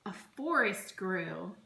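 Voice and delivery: plain reading voice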